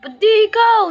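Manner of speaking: loud, high taunting shout; challenging shout. Retold or imitated. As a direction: throw the challenge back hard. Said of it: "sabi ko". (malakas na hamon na pasigaw)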